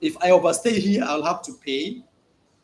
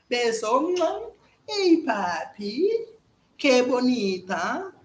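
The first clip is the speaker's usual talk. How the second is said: slow sweet coo, higher; flirty Colombian woman; 5 semitones higher, much slower than usual. Retold or imitated. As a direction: draw the man in gently. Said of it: "Beso means kiss".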